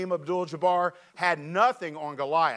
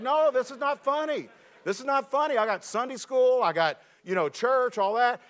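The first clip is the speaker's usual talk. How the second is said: high, exasperated complaint; indignant protest; 6 semitones higher than usual. Retold or imitated. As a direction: insist it's not funny, half pleading. Pastor protesting while friend laughs.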